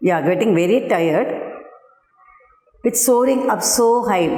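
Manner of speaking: lightly voiced sweet spider; a hint of coaxing concern. Teacher voicing the spider to her pupils, mild coax. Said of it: "The spider said".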